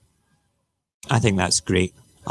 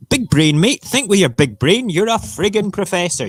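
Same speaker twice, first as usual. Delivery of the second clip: raised mocking telling-off, higher and louder; mock scolding; 9 semitones higher, 4 dB louder than usual. Vlogger mock-scolds the professor over lockdown, loud and high. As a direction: tell the professor off like a cross parent.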